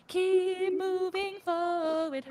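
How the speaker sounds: high, long-drawn singsong words; playful drawl. Pretend. Podcaster cheering on after a laugh, playful drawn-out singsong. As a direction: stretch every word out playfully. Playful stretched-out repeat of phrase.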